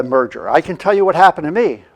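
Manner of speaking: ordinary talk, nothing marked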